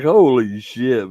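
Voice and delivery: long astonished exclamation; stunned disbelief. Retold or imitated. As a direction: stretch it out in amazement. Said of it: "I was like".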